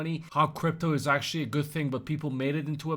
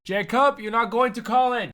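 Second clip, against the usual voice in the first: high, loud mocking father; a ruined dad breaking the news; dad yelling at Jacob that college is off; mock dad, deflated yell; 9 semitones higher, 9 dB louder than usual. Dad after the coin crashes.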